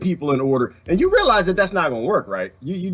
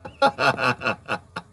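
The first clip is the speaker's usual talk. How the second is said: laughter; 4 semitones lower, 3 dB quieter than usual. Real, not acted. Laughing at the picture.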